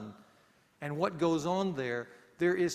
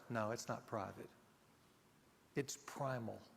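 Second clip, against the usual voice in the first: hushed inner thought; 6 semitones lower, 13 dB quieter than usual. Retold or imitated.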